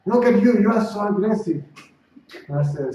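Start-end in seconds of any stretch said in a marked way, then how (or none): 0.0-1.6 raised, higher-pitched reproach; retold angry accusation. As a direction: imitate an angry man hitting back with an accusation. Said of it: "He saw that I was aggressive".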